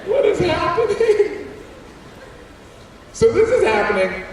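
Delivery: put-on new kid lost at photo day; baffled squeak. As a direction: squeal in total confusion. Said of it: "confused kid".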